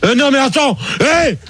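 high-pitched, indignant scolding; angry outburst. Real, not acted. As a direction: burst out in real outrage. Host angrily scolds phone screener.

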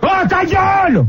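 high, drawn-out exasperated yell; angry shout. Real, not acted. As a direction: bawl at a caller mocking you. Host shouts down echoing caller. (cri exaspéré, aigu et traînant)

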